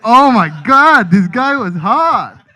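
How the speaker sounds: slow, loud swooning gush; drawn-out lovestruck swoon. Pretend. Swooning over the agent.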